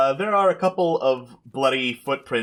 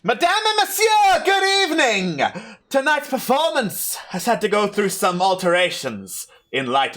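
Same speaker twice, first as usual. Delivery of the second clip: theatrical stage announcer; 10 semitones higher than usual. Acted. Unseen host presents a tragedy to the intruders, theatrical announcing. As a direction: announce the show with high-flown, stagey flourish. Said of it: "And you hear".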